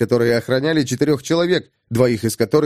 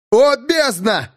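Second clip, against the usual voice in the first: drawn-out high yell; yelling over fire; 10 semitones higher, much slower than usual. Acted. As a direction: shout it over a roaring fire. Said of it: "заорал Кирсон, стараясь перекричать гул огня".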